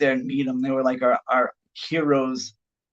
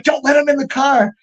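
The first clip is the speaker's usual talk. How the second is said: urgent warning; 11 semitones higher, 6 dB louder than usual. Retold or imitated. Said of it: "Chavo's like".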